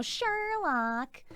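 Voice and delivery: slow, lilting "Sherlock"; calling the cat. Shown as a demonstration. Calling the cat over by name, a slow lilting "Sherlock". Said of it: "That's how I say it".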